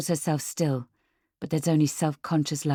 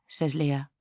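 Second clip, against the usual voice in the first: plain reading voice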